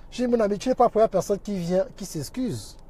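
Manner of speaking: usual speaking voice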